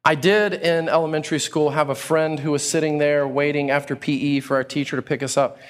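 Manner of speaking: ordinary talk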